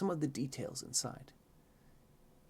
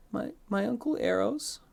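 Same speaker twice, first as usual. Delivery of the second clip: put-on sniffly child; 12 semitones higher than usual. A narrator's reading. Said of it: "You sniffle".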